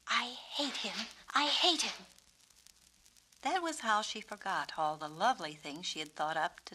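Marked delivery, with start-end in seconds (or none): none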